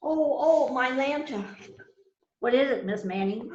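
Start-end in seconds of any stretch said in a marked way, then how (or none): 0.1-1.5 higher, louder alarmed oh, oh; startled cry. Acted. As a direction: cry out in sudden fright. Sudden alarm over the lantern.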